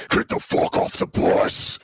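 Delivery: slow, unvoiced monster growl; skeletal monster. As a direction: rasp it out as a terrifying skull-face. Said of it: "completely skeletal face".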